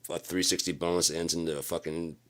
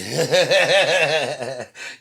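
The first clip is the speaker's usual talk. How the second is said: mocking laugh; 10 semitones higher, 12 dB louder than usual. Mocking laugh in retold fight.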